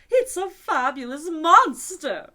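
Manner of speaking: very loud, high, slow cry; unicorn cries out. A narrator's reading. Unicorn crying out about Alice, very loud and high. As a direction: announce it to the world. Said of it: "the unicorn cried out".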